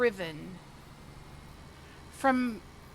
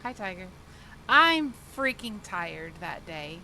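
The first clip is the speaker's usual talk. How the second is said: ordinary talk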